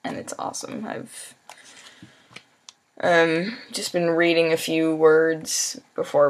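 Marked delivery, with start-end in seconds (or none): none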